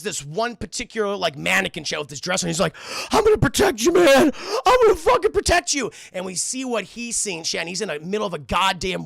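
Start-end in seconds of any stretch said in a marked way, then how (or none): 3.1-5.9 very high, loud frantic yell; crazed screaming. Acted. Mister Cunningham vowing to protect his mannequin, high frantic scream. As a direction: scream it like a desperate madman.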